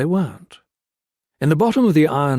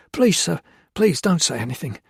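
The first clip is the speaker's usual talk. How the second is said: pleading; 6 semitones higher than usual. A narrator's reading. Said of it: "wringing his hands".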